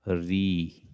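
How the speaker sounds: ordinary talk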